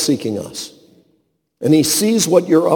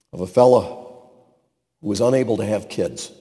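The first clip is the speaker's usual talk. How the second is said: ordinary talk, nothing marked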